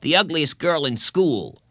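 lightly voiced kid's put-down; taunting boy. A narrator's reading. Boy insulting a girl.

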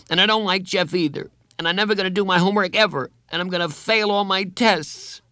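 put-on high, childlike angry rant; defiant outburst. A narrator's reading. Boy threatens failure to spite counselor, defiant.

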